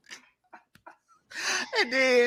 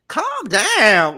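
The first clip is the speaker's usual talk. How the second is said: louder, exasperated calm down; exasperated plea; 6 semitones higher, 6 dB louder than usual. Retold or imitated. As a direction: push him back, annoyed. Retells telling him to slow down.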